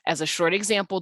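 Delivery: usual speaking voice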